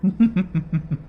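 short smug laugh, falling; a knowing villain's chuckle; sly villain laugh. Acted. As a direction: chuckle like you know the secret. Villain laughs at her question.

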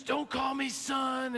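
high, slow, strained mock anger without much volume; imitated outrage. Hiker bristles at being called son, mimicked outrage. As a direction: mimic a man about to explode. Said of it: "his head's about to explode".

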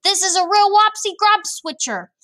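very high, loud exclamation; worked-up storybook giant; alarmed cry. A narrator's reading. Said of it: "cried the B F G".

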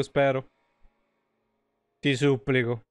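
normal talking voice

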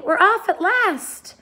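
high, slow, jubilant cry; excited announcement. A narrator's reading. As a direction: draw it out high in triumph. Joyous departure cry.

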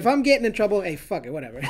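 usual speaking voice

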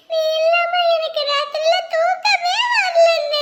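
high, drawn-out tearful plea; tearful wailing. Acted. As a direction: wail slowly to the big brother who left. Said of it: "அதுக்கு போய் அழலாமா ரெண்டு பேரும்?". (அழுதபடி இழுத்து, உயர்ந்த குரலில்)

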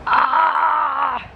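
short dismayed shout; frustrated yelp at the long walk; dismayed cry. Real, not acted. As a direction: cry out at the bad news. Reacts to six hour walk.

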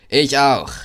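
loud, high ready call; eager call. A narrator's reading. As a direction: call it out eagerly like an engine raring to go. Henry meldet sich bereit. (laut und hoch gerufen)

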